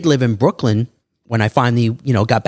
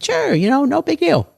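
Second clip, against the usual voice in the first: a carefree, far higher sure; high breezy agreement. Retold or imitated. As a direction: toss it off way up high, easygoing. Retold easy agreement to favor.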